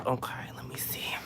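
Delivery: breathy whisper to herself; whispered aside. Real, not acted. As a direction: whisper it under her breath. Reading a viewer's message.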